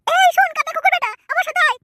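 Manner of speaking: scolding call. Acted. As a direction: bark the insult, then order him over. Character summons servant.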